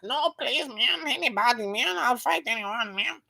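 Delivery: high, soft, whiny imitation of pleading; mock begging. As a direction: plead pathetically in a squeaky put-on voice. Imitating a fighter begging for bout.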